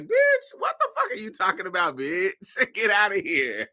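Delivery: mock cuss-out. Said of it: "any regular person will cuss her ass out".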